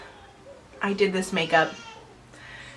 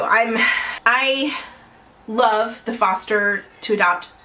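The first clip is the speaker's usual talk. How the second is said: usual speaking voice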